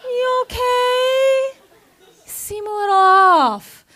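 very high, sweet checking-in voice; sugary manager. Retold or imitated. Manager checking on employee, high sweet coo. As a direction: coo like a concerned boss. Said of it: "she's like".

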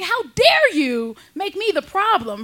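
high, slow, indignant; mock indignant. Wife scolding cheating husband, high slow indignation. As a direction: spell it out, offended.

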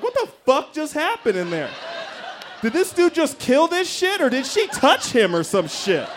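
high-pitched incredulous onlookers; mock shock. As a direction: imitate baffled bystanders gossiping in alarm. Onlookers' shocked reaction to his sobbing.

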